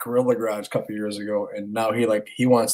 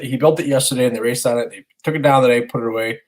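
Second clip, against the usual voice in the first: ordinary talk, nothing marked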